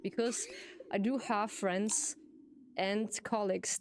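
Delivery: ordinary talk, nothing marked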